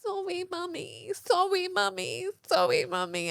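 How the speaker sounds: put-on high little-boy voice, lightly done; toddler apologising. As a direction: sound like a small sorry child. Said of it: "And he's there going".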